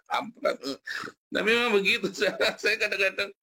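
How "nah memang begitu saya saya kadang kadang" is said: high, louder, laughing through the words; real laughing. Real, not acted. Speaker amused by their own story about Germans, laughing. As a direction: talk while cracking up.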